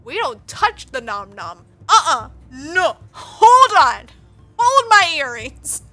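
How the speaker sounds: sassy mock refusal. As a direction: start under your breath, build to a loud hold-me-back outburst. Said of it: "just imagine him being like".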